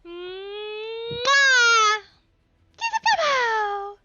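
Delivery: very high squeaky coo to a pet. Real, not acted. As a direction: coo like talking to a newborn puppy. Squealing kisses at the dog.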